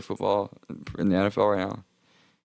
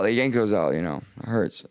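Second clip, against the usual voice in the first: ordinary talk, nothing marked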